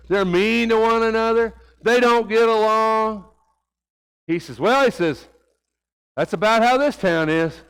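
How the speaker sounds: fiery sermon voice; preaching at full force. Acted. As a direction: drive the message home from the pulpit.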